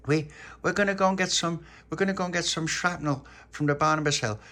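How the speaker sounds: put-on high, excited child character, settling slightly; Little Pappy, eager. A narrator's reading. Little Pappy telling Dolores and Charlotte the plan, high and eager.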